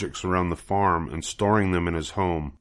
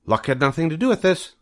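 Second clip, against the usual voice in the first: much higher, quick goose voice; proud goose; 9 semitones higher, faster than usual. Acted. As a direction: fire it back, proud and brisk.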